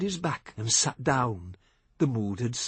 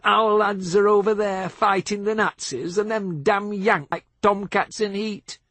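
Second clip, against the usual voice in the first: loud, higher, scornful local; hostile jeer; 8 semitones higher, 4 dB louder than usual. A narrator's reading. Pub local sneering at the American soldiers, loud and scornful. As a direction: spit it out with contempt.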